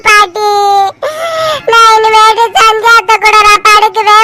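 put-on tearful, whining child's complaint; crying child. Child telling grandmother how aunt scared her, crying. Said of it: "அழுவாத, ஏன் அழுவுற".

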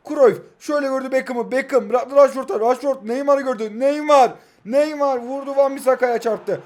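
loud, rising football commentator call; excited play-by-play. Acted. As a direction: call the match like a live TV commentator. Live play-by-play of own match.

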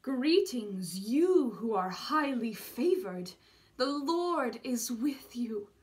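loud, slow, grand proclamation; booming heavenly voice. A narrator's reading. Unseen angel greets the chosen young woman, loud slow proclamation. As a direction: proclaim it out of the silence, drawn out. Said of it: "when a voice broke the silence".

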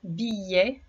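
ordinary delivery

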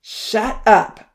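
loud furious outburst; angry shout. A narrator's reading. Odin silencing Poncy, loud angry shout. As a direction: shout him down. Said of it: "Odin exclaimed".

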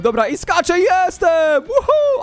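rising high-pitched victory cry; triumphant whoop. Real, not acted. Player makes the final jump, rising triumphant whoop. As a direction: climb up into a whoop.

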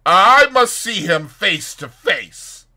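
slow, loud, high-pitched resolve; sudden decision. A narrator's reading. Fetz resolves to confront the doctor, loudly decisive. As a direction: announce it forcefully, drawing out each word. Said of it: "with a sudden decision".

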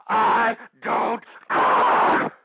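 loud stretched defiance; defiant shout. Real, not acted. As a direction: yell it out, defiant. Defiant outburst after calm words.

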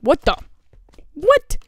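loud, high, incredulous what; startled disbelief. Real, not acted. Gamer baffled by the trap, startled and high. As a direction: blurt it, jumping up in pitch.

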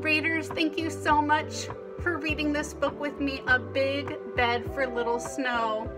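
ordinary delivery

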